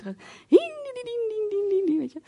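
put-on high squeaky ding-ding of old car brakes; imitated brake squeal. Preacher imitating her brother's car braking, high squeak. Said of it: "de klank van een eend die afremt".

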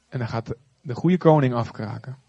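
ordinary talk, nothing marked

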